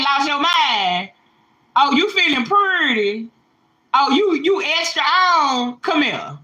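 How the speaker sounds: lightly voiced mocking God; God teasing. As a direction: egg her on like a knowing God. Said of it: "God have a way of saying".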